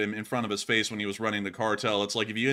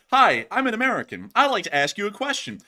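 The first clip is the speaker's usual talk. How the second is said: chirpy, higher and louder mock greeting; naive journalist; 9 semitones higher, 6 dB louder than usual. American journalist greeting Hezbollah fighters, chirpy mock voice. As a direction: play the clueless reporter walking in. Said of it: "you go".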